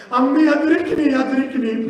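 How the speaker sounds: high, loud calling out, fading on the repeat; Arabic plea cried out loud; anguished call. Acted. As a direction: call desperately for the uncle. Fallen boy calls uncle in Arabic.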